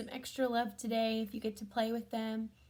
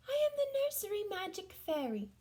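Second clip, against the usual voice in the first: fairy voice; 9 semitones higher than usual. Acted. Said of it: "she said".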